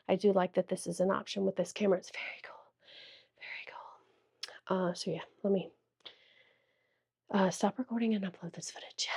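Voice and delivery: soft unvoiced whisper. Real, not acted. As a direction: whisper it close to the mic. Whispering while testing camera mic.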